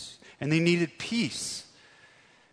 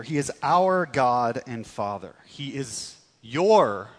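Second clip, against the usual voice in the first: "our", then "your" drawn out slowly and louder; stress on our and your; 5 dB louder than usual. Shown as a demonstration. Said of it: "adding an emphasis on the word our".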